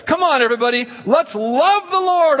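high, rousing call to a crowd; calling everybody. Acted. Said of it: "he calls everybody to join him".

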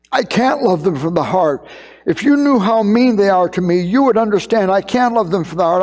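a hint of a tearful eight-year-old girl, pleading; weeping daughter. Father retelling his crying daughter's bedtime complaint, mildly tearful child voice. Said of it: "she was weeping".

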